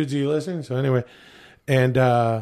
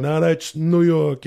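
slow, slightly raised New York catchphrase; 3 semitones higher, slower than usual. Shown as a demonstration. Actor showing how his New York videos go. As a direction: lean into the bit, slowed down. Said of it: "I would make these videos".

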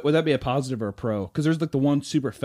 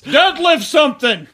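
mocking voter; 10 semitones higher, 9 dB louder, slower than usual. Mock voter complaint.

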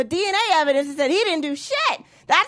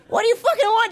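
ordinary talk, nothing marked

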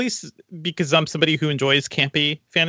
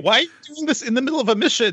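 mock whiny son; 9 semitones higher than usual. Mocking son during the mission.

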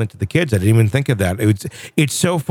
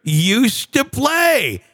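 cheesy tour-guide dad voice, much higher; Chevy Chase dad; 5 semitones higher than usual. Pretend. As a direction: play the goofy dad showing the kids around. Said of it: "in a weird Chevy Chase moment".

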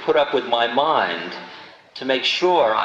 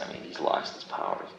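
confiding whisper; 10 dB quieter than usual. Retold or imitated. Retold private doubt about guru.

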